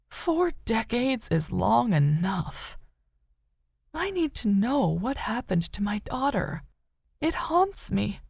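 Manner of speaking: a mother who refuses to let go; grieving mother. A narrator's reading. Rose tells Katie of her husband's death, quietly grieving. Rose's voice.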